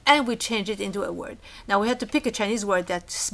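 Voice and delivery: usual speaking voice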